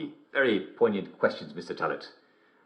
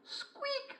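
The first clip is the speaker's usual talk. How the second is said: tiny, very high squeak; mouse squeak; 14 dB quieter than usual. Acted. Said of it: "said a mouse".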